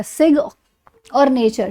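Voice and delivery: "सिग", pause, "और नेचर"; split into parts. Shown as a demonstration. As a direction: break it after the G of "सिग", then "नेचर". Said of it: "इतने को एक साथ pronounce".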